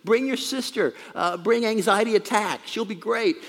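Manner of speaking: high, loud-ish party invitation; calling friends over. Acted. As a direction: call your pals over to a party. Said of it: "and starts calling his friends".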